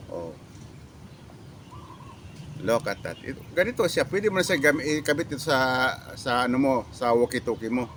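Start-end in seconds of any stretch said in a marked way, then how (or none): none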